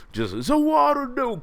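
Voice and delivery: high stretched Za Warudo cry; anime villain. Pretend. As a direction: call it out like an anime villain stopping time. Anime time-stop reference.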